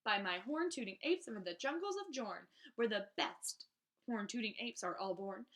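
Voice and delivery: plain reading voice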